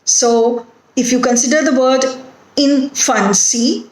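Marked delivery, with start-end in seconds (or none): 2.6-3.9 "infancy" broken into in, fan, cy, rising at the end; syllable by syllable. Shown as a demonstration. Teacher counting syllables for students, "infancy" said syllable by syllable. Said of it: "it has three syllables in it".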